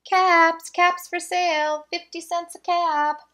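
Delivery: high, loud calling out wares; street vendor's call. Acted. Peddler calling to passersby in the street, loud and high. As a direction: sing out the caps for sale.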